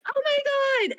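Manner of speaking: high, piercing mock scream of panic; retold scream. Faking panic to make the kidnappers' car stop, high scream. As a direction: shriek it like you've just seen danger. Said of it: "I scream so loud that they slam on their brakes".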